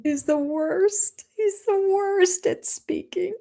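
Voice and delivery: high, slow drawn-out whine; exasperated whine. Real, not acted. Host mocking Cody's speaking, high slow whine. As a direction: stretch it out in disbelief.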